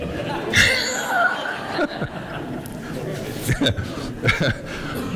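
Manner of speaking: high airy laughter, no voice; high breathy laugh. Real, not acted. Preacher laughing at his own genie punchline, high and airy.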